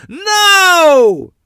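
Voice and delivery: long, high, loud howl; blood-curdling yell. A narrator's reading. Car owner watching a rock hit his car, yelling. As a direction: scream it out in horror. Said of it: "I heard a blood-curdling yell".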